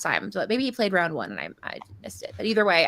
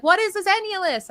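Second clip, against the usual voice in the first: high-pitched puzzled question; imitated bafflement; 10 semitones higher, 4 dB louder than usual. Shop lady asking customer about odd name, high and baffled.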